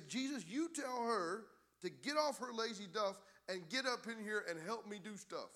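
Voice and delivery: audacious demand. Acted. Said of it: "she had the audacity to say".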